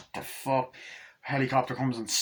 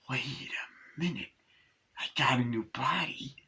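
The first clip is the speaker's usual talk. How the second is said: scheming whisper; 5 dB quieter than usual. Retold or imitated. Chucky plotting alone, sinister whisper.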